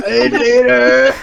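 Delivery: stretched, high, loud yell; mock catchphrase yell. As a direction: holler it while laughing. Mocking the tagline.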